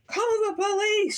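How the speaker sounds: loud, high storybook holler; angry shout. A narrator's reading. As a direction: shout the order in outrage. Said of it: "shouted Mister Frizzle".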